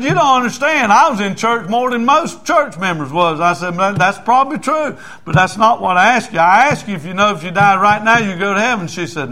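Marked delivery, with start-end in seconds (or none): 0.0-3.4 high, loud retold wife; raised, argumentative comeback; indignant protest. Wife argues back, retold. 3.8-8.9 high, loud insistence; raised, emphatic retort; pressing the question. Retold or imitated. Preacher presses the wife, retold.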